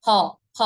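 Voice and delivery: ফ as an aspirated p; both lips touching. Shown as a demonstration. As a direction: close both lips, then pop ফ out. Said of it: "দুইটা ঠোঁট একসাথে স্পর্শ হবে". (দুই ঠোঁট ছুঁয়ে ফ)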